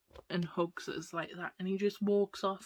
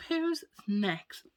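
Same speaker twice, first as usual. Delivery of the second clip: ordinary talk, nothing marked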